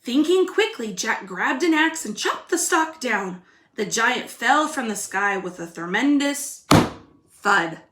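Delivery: put-on lively storybook reading for kids; reading to children. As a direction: read it like a picture book to little ones. Teacher reads story aloud.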